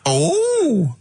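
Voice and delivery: high, drawn-out swooping greeting; hammed-up comedy character, pitched very high; Dirty Old Queer. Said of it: "it's Dirty Old Queer".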